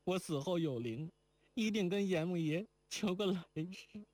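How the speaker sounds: saying farewell to her lover in tears; tearful young woman. A narrator's reading. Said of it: "又抱着金环哭道". (含泪的女子，轻声哭诉)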